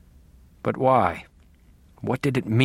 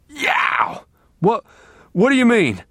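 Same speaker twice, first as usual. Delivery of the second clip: startled pained cry; 11 semitones higher, 4 dB louder, slower than usual. Acted. Duct tape ripped from mouth.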